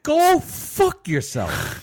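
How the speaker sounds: mock outburst. Mock insult to co-host.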